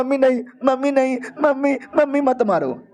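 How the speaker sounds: put-on high, frightened childlike pleading; scared pleading. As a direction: beg for mercy like a terrified child. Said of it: "तभी वो डर जाता है". (डरी हुई, ऊँची आवाज़ में गिड़गिड़ाना)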